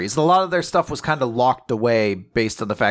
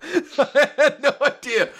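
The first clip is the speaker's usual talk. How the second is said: laughing; 13 semitones higher, slower than usual. Real, not acted. Laughing after the quote.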